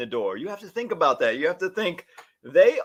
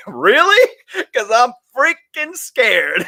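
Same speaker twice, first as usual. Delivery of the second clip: much higher, louder mock alarm, then slowing down; freaked out; 9 semitones higher, 8 dB louder, slower than usual. Nervous customer objects to the wild animal comparison, in mock alarm. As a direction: protest in half-joking panic.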